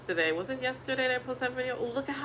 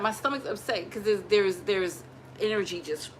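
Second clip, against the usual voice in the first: ordinary talk, nothing marked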